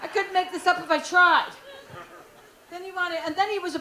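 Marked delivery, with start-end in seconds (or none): none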